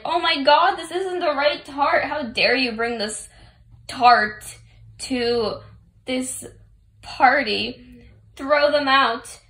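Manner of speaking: loud, high indignant shriek; imitating a furious spoiled tyrant; angry tyrant outburst. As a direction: blow up in outrage at the wrong tart. Tart tyrant rages at them.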